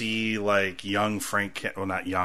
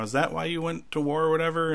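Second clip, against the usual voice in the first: lightly voiced child, pitched up; retold daughter's higher-pitched question; 7 semitones higher than usual. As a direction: ask it like a kid, higher than your own voice. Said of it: "his daughter's asking, like".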